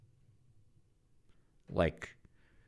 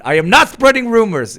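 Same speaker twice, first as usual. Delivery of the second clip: much higher, much louder laughing protest; near-yelling denial; shrieking denial; 12 dB louder than usual. Real, not acted. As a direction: shriek "I am not spreading rumors" in mock outrage.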